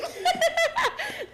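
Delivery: sudden loud high laughter. Real, not acted. Host cracking up at the guest's face. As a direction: burst out laughing.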